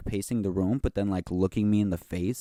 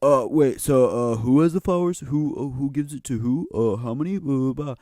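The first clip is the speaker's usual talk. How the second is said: higher, louder dopey castmate voice, lost and fumbling; clueless castmate; 7 semitones higher, 6 dB louder than usual. Retold or imitated. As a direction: play the confused actor who forgot everything.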